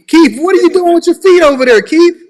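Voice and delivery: high, loud startled exclaim; surprised. Real, not acted. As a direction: shout it out in surprise. Host exclaims at caller's feet.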